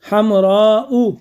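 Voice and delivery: हमराउ stretched out long, every sound held; very slow example word. Shown as a demonstration. Teacher to students, pronouncing the example very slowly. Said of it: "जैसे".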